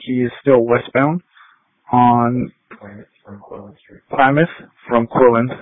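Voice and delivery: ordinary talk, nothing marked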